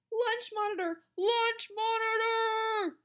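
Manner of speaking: high, loud call, second one stretched; crying out a warning. A narrator's reading. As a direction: raise the alarm, stretching the repeat. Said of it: "he cried".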